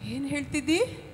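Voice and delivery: ordinary delivery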